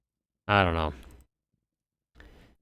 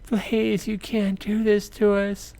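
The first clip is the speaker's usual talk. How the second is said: high, pleading mother's cry; desperate plea; 13 semitones higher, slower than usual. Retold or imitated. Mother begs Jacob not to kick them out, high and desperate. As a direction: beg not to be thrown out. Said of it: "she's like".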